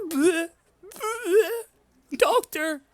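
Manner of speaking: high, loud taunting sing-song; high mocking taunt; mocking bleh. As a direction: jeer at him in a high voice. GM voices a mocking figure.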